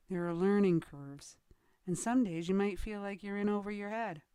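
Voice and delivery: ordinary talk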